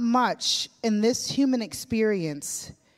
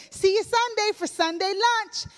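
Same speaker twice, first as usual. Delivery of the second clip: very high, beaming farewell; all smile; 12 semitones higher than usual. Retold or imitated. Newlywed beaming at Nana, high and eager. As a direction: say it grinning with all the teeth showing. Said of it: "And I'm all smile".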